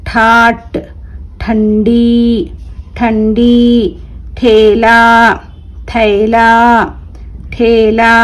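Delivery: each word drawn out slowly, clearly; careful drawn-out classroom reading; slow word-list reading. Shown as a demonstration. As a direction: read it slowly for children to repeat. Teacher reads letters and words again.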